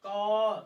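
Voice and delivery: कौर said with a long open aw and no r; long aa demo. Shown as a demonstration. Teacher modelling the long vowel in कौर. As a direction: stretch the vowel of कौर, drop the r. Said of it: "बड़ा आ का उच्चारण कौर".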